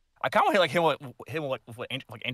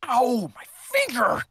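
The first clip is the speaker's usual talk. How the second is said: mock yelp; 12 semitones higher, 6 dB louder than usual. Mock pain during play.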